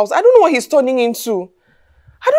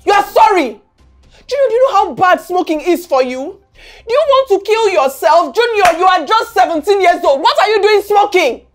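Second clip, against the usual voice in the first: raised, higher-pitched reproach; angry scolding; 3 semitones higher than usual. Acted. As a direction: lay into your child, appalled. Mother scolds son for smoking.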